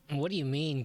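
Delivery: ordinary talk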